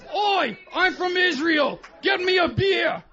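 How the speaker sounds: put-on high, slow, shouted English accent; same bad English accent; English accent for Israel. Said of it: "I can do an impression of any country".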